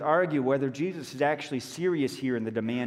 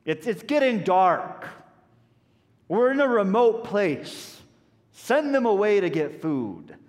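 higher, louder, slowing at the end; impatient; 4 semitones higher, 4 dB louder than usual. Retold or imitated. Disciples urging Jesus to send the crowd away, impatient. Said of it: "that impatience".

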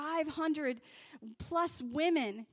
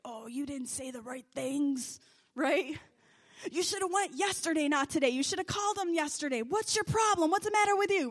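devil's accusing voice; 3 semitones higher, 4 dB louder than usual. Retold or imitated. The devil's voice accusing the young woman, rising to a loud taunt. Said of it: "No, that was the devil".

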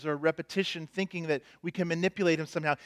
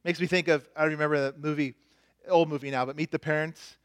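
normal talking voice

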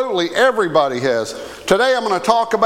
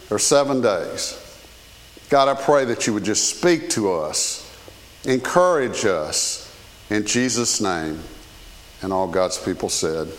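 lower, earnest spoken prayer; 5 semitones lower than usual. Real, not acted. Pastor praying over the church before the message, earnest prayer. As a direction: pray for the congregation in a subdued, lowered voice.

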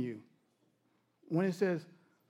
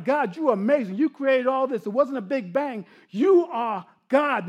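loud, fervent cry of praise; raised voice, building to an emphatic declaration; 4 semitones higher, 6 dB louder than usual. Acted. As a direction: cry it out as all creation praising God. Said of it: "all those things are crying".

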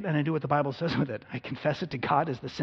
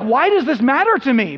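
much higher and louder frustrated cry; exasperated outburst; exasperated self-question; 9 semitones higher, 9 dB louder than usual. Retold or imitated. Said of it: "I'm like".

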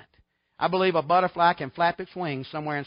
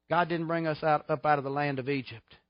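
normal talking voice

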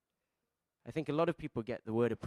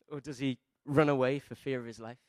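usual speaking voice

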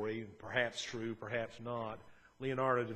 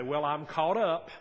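louder, pleased with oneself; a self-satisfied pat on the back, louder; smug self-satisfied; 3 semitones higher, 9 dB louder than usual. Retold or imitated. Churchgoer imagining he is done with forgiving, loudly smug.

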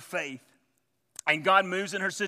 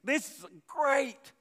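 high, loud, self-satisfied gloating; proud gloating thoughts; 6 semitones higher, 4 dB louder than usual. Retold or imitated. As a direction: puff up like a proud man rising in rank. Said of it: "He thinks".

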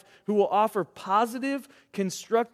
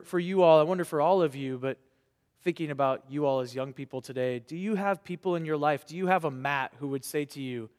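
ordinary delivery

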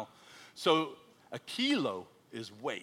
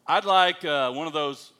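put-on loud, booming American accent; strong American accent; 2 semitones higher, 10 dB louder, slower than usual. American ordering in an Australian shop, loud strong American accent. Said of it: "that strong American accent".

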